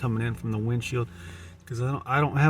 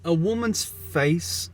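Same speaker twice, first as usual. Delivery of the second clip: higher, slow feminine voice; high woman voice; 6 semitones higher, much slower than usual. Pretend. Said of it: "I've already changed my voice".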